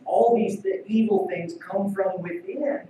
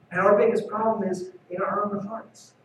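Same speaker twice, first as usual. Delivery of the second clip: ordinary delivery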